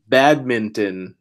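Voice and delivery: badminton said slowly and clearly; slow example word. Shown as a demonstration. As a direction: sound out badminton. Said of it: "just like the B in".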